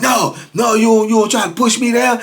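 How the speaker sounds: high, indignant retort; retold outraged protest; imitated angry refusal. Customer angrily rejects the apology.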